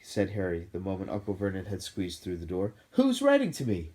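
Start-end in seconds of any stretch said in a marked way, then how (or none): 2.9-3.9 insistent question. A narrator's reading. Harry confronts uncle.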